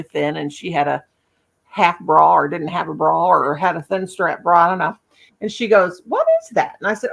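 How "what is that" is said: put-on high curious child voice; imitating a small girl's question; little girl asking. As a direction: ask like a nosy little girl.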